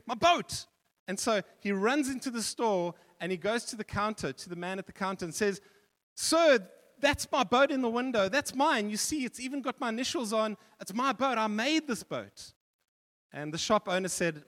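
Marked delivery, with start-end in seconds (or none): 0.1-0.7 my boat! pitched higher, louder; a boy's glad shout on spotting it; surprised joy at the shop window; excited cry. A narrator's reading. Boy spots his lost boat. 6.1-12.5 put-on higher, louder child's voice, insistent; a boy claiming his boat; little boy begging the shopkeeper; pleading boy. A narrator's reading. Boy pleads with shop owner.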